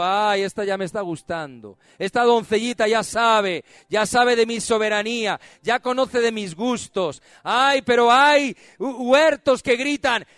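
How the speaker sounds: loud, lightly voiced God, delighted; voicing God. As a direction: speak as a pleased God, loud. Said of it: "Y dice Dios". (Dios hablando, voz alta y complacida)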